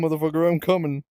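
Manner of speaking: usual speaking voice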